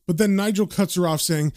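ordinary delivery, nothing marked

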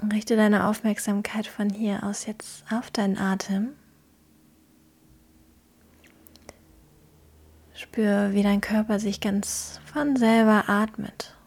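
calm, gentle guiding through a visualisation; guided meditation. Acted.

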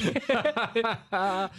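rolling laughter at the story; hearty laugh. Real, not acted. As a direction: laugh it out fully. Laughing at his own story.

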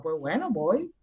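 ordinary delivery

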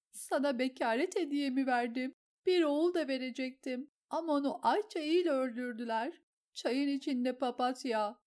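high, loud, slowing tearful lament; weeping confession. A narrator's reading. Lysa confesses her lost child to Petyr, weeping. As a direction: pour out the old grief.

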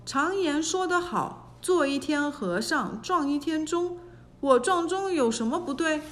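ordinary delivery, nothing marked

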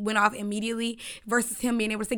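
usual speaking voice

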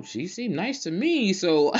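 1 mock innocent. Innocent reply to angry friend.

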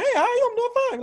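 falsetto reply, very high; high-pitched greeting. Retold or imitated. Answering someone's squeaky hello, in falsetto. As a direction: copy the high voice back brightly. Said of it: "follow the high pitched voice".

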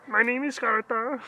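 high, slow Scandinavian woman voice; drawn-out high put-on woman; Gartha voice. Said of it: "that's actually how Gartha will sound".

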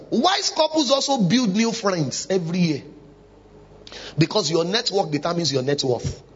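usual speaking voice

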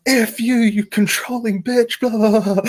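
high, loud mock rage at the therapist; imitating a tantrum. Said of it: "Chuck a tantrum".

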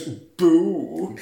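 sassy drawn-out boo, imitated; mock-sassy vampire boo. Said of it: "And he's really sassy".

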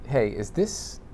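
usual speaking voice

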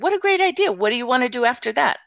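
put-on high, bubbly praise for a child; encouraging enthusiasm, retold. Grandma cheering on granddaughter's plan, high enthusiasm.

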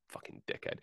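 muttered curse under the breath; barely audible swear, hidden from the guy; whispered insult. Retold or imitated. To the rude guy at the gym, a muttered unvoiced curse.

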